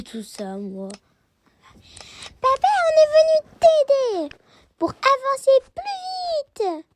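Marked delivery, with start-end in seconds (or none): 2.4-6.8 put-on a keen kid offering to help; children offering to help their father pack, high and eager.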